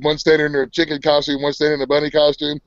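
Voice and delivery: ordinary delivery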